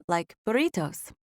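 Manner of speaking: put-on lightly hinted Mexican accent, rolled R's; mild put-on Mexican accent on burritos; thick Mexican accent. A narrator's reading. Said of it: "in a super thick Mexican accent".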